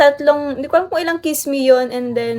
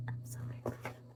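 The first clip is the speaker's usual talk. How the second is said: whisper; 26 dB quieter, much faster than usual. Real, not acted. Repeats happy line under breath.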